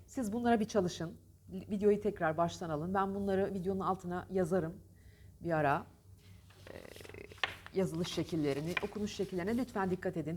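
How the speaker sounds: normal talking voice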